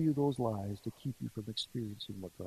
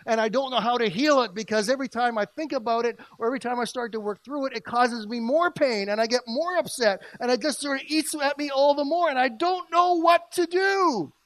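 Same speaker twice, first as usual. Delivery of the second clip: anguished outburst; 10 semitones higher, 8 dB louder than usual. Acted. Preacher voicing someone whose hurt won't heal, anguished. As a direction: cry out in desperation, rising to the end. Acting out a hurting person's despair.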